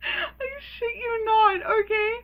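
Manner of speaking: high squeal through helpless laughter; real laughing. Real, not acted. Vlogger swearing the story is true, cracking up. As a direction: barely get it out for laughing.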